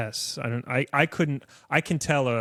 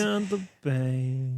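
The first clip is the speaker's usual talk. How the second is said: slow, drawn-out Nordeste imitation; mock Nordeste drawl; Nordeste accent; slower than usual. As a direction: echo the slow Northeastern Brazilian drawl. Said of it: "Just, like, super slow and".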